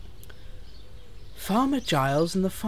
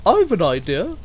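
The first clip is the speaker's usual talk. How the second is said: higher, louder coach voice; high-pitched helpful coach; Annie; 5 semitones higher, 6 dB louder than usual. Acted. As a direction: pipe up with a suggestion. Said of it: "said Annie".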